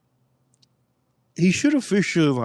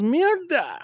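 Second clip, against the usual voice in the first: high disgusted Spanish curse; Colombian dealer cursing; 13 semitones higher, 4 dB quieter than usual. Retold or imitated. Dealer reacting to the video, high disgusted curse in Spanish. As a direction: blurt "Mierda!" high and disgusted. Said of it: "He goes".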